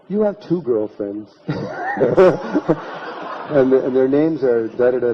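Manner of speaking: words breaking into real laughter; can't hold back a laugh as the secret comes out. Real, not acted. Telling the audience about the two girlfriends, laughing.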